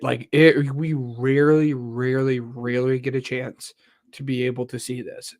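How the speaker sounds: ordinary talk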